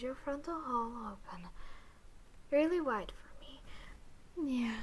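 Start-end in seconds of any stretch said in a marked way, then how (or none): none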